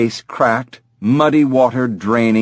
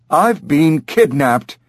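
slow, higher-pitched talking parrot; indignant parrot; 7 semitones higher, slower than usual. Acted. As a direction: declare it slowly as an aggrieved parrot. Parrot character announcing a kidnapping.

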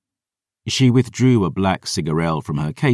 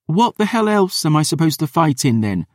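put-on higher, defiant young woman; 10 semitones higher than usual. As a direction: play the stubborn girl. Dior's girl voice.

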